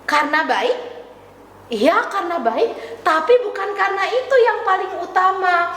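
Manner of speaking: ordinary delivery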